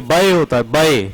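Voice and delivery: बय said as a diphthong, ba-ay; teacher contrasts बय with बे; bay not bey. Shown as a demonstration. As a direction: glide बय from a to ee. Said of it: "ये बे नहीं होता".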